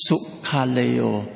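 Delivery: sukkaleo said slowly, syllable by syllable. Shown as a demonstration. Preacher teaching the Greek word sukkaleo slowly. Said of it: "the first compound word is".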